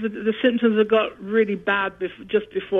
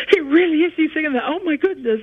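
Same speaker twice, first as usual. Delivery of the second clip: high, loud imitation of astonished nurses; excited, high-pitched retold amazement; amazed recognition; 7 semitones higher, 3 dB louder than usual. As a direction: act out starstruck disbelief.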